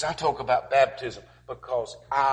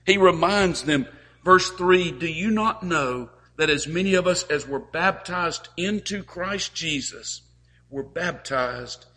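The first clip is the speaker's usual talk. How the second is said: louder, higher sermon appeal; fervent preaching; 5 semitones higher, 7 dB louder than usual. Acted. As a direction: preach it out to the congregation.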